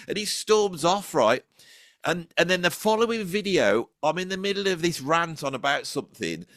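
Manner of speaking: normal talking voice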